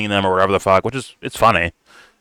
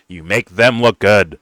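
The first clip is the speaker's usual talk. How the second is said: ordinary talk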